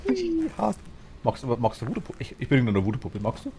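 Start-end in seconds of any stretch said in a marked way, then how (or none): 0.1-0.8 high squeal. Pretend. Playful excited squeal.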